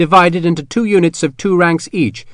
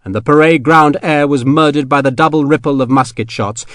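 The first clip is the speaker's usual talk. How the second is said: ordinary delivery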